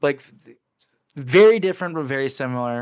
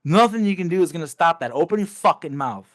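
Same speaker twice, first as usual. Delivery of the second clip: furious threat; 6 semitones higher, 5 dB louder than usual. Retold or imitated. To the guy he kills, raised furious threat. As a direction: threaten him, each word hammered. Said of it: "I'm like".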